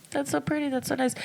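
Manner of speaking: put-on high, soft, politely pleased old lady; polite grandma. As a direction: coo it like a sweet grandma being polite. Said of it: "and she's like".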